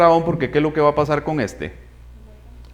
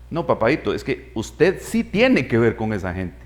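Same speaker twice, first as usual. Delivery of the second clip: softer, lower, talking down gently; patronizing tenderness; 7 semitones lower, 7 dB quieter than usual. Retold or imitated. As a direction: humor a stubborn child, softly. Réplica a Moisés como a niño. (réplica suave y condescendiente a Moisés)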